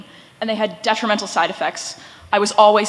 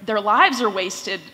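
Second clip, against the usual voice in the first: ordinary talk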